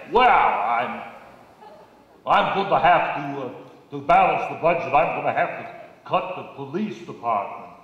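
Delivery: put-on Austrian-accented governor voice announcing budget cuts; Schwarzenegger impression. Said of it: "Arnold Schwarzenegger".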